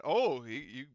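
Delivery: ordinary delivery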